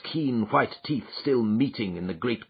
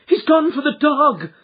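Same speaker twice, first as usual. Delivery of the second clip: high, loud alarmed cry; young woman warning of the dog; frightened cry; 9 dB louder than usual. A narrator's reading. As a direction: warn them in panic. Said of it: "cried Miss Hunter".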